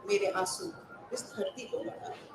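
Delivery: "मेरे आंसू इस धरती को लौटा दे" breathed out in a whisper; घुटती फुसफुसाहट में टेक; whispered refrain. Acted. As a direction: let the refrain fade to a hush.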